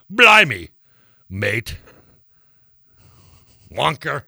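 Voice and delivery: mock British exclamations, one at a time; British accent. Shown as a demonstration. Co-host testing whether he can do it, British accent. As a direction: try out a hammed-up British accent. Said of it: "if I can do British accent".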